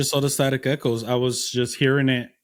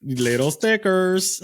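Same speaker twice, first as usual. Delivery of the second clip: slow, higher mimicking sing-out; playful mimicry; 4 semitones higher, slower than usual. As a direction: echo it back drawn out and pitched up. Playfully mimics the other's accented line.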